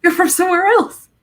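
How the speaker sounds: high, loud imitation of a startled stranger; mock astonishment. Americans reacting to her accent, mock astonishment. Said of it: "everyone goes, "Oh".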